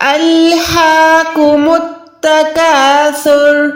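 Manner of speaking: الہاکم with the ha held for a second; slow recitation, long ha in الہاکم; stretched ha. Shown as a demonstration. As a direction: draw out the ha in الہاکم, recited slowly. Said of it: "میرے ساتھ practice کریں".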